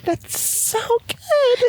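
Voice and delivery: very high, very slow delight; high drawn-out squeal. Real, not acted. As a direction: squeal it out slowly. Laughing approval.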